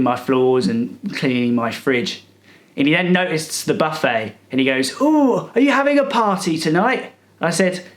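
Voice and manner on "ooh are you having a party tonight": higher, lightly voiced old man; a touch of a nosy old busybody; mock nosy coo. Retelling the cleaner's nosy question.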